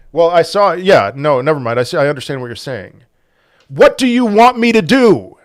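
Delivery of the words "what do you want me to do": higher, louder exasperated demand; getting mad. Real, not acted. Streamer to viewer who blamed him for losses, angry outburst. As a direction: snap back at the accusing viewer. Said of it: "So I'm getting mad again".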